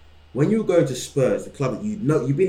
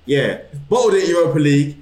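normal talking voice

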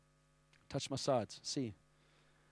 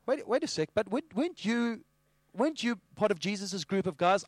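tag; 5 semitones higher than usual. Acted. Third bystander questions Peter.

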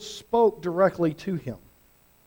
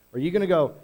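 ordinary delivery

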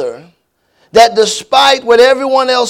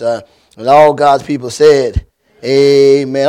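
lower, earnest prayer voice calling on God; pastor's prayer; 4 semitones lower than usual. Acted. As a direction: pray over the church, steady and reverent. Leads the church in prayer.

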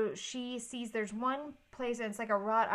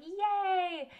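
high, loud, drawn-out cheer; mock family cheer; 10 semitones higher, 5 dB louder than usual. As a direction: cheer like a delighted family watching. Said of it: "they're all, like, sitting around like".

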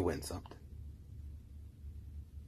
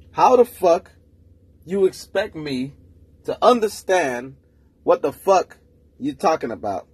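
louder, higher, slow and drawn out on each chunk; exasperated emphatic repeat; 4 semitones higher, 4 dB louder, slower than usual. Real, not acted. Ranting to listeners about a girl's confusing talk, exasperated emphatic repeat. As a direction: spell it out like nobody's listening.

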